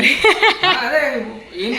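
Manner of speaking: real laugh breaking into the words; high, laughing reply; laughing along at the story. Real, not acted. Laughs at the situation.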